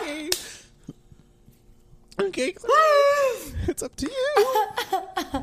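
very high, slow mocking voice; mock her in a squeaky voice. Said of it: "I'm like".

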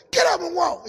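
high, loud order to stand; preacher yelling at the seated man; shouted command. Retold or imitated. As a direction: make him walk, shout it.

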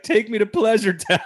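mock swooning movie line, high and loud; dreamy Christina Applegate. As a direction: float it up like you're riding a rainbow. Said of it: "and she's like".

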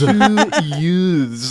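"Two youths" with an overdone "th"; imitating the lawyer's careful "youths"; mock over-enunciation. Fan mimics the lawyer's corrected word, over-enunciated. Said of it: "Like his over-enunciation of the, Gentile accent".

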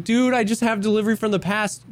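imitated excited gamer; eager player impersonation. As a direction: act out an eager veteran offering help. Voices eager high-level player offering help.

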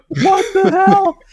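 high, drawn-out "what the hell" with a laugh inside; stunned disbelief. Retold or imitated. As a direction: gasp it out in astonishment. Onlookers stunned he walks out.

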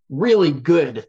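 really good, drawn out slowly through a laugh; laughing stress on really good. Real, not acted. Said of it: "my caveat was more around".

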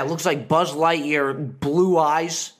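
usual speaking voice

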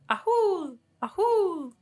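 high, hooting animal cry; creature call. Retold or imitated. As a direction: call it out like a strange beast. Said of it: "clearly shouting the word".

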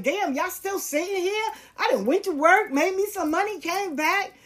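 high-pitched disbelief, imitating nosy passersby; mock incredulous passersby. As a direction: imitate someone amazed to find them still there. Passersby's disbelief acted in story.

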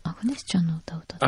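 quick, hushed whisper; soft whispered repeat; whispered echo. Real, not acted. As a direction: repeat it under your breath, low and fast. Echoing the guess under her breath.

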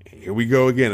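normal talking voice

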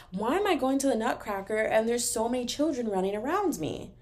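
normal talking voice

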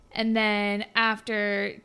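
normal talking voice

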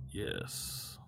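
low whispered hiss of approval; hooded figure. Acted. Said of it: "you hear the voice go".

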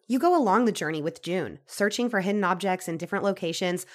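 upbeat sponsor read. Acted. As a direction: read it like a podcast ad. Reads a sponsor ad.

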